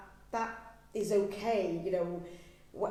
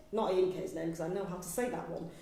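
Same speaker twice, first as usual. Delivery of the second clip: ordinary talk, nothing marked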